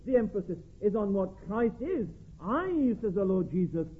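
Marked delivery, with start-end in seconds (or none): 2.4-3.0 "I" pitched high and held out; stress on I. Shown as a demonstration. Preacher quoting the Lord Jesus to show who is the way, stress on "I". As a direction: lean hard on the pronoun "I". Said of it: "The emphasis on who is the way".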